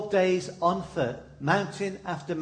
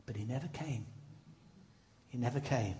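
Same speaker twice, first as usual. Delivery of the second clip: much softer and lower, sorrowful; hushed sad repetition; 6 semitones lower, 14 dB quieter, faster than usual. Real, not acted. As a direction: let it fall away quietly.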